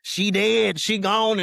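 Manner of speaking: loud, high, hammed-up announcement; mock dramatic. Father imitating how not to tell his son, mock dramatic. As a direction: blurt it out with overblown drama. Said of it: "all this other dramatic kinda stuff".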